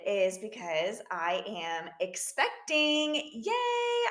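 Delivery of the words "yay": excited cheer. Real, not acted. Announcing her pregnancy.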